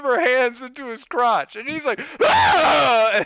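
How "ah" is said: loud, high mock yelp of shock; startled yell. Friend grabbed by surprise, acted startled yell. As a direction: imitate a man suddenly grabbed.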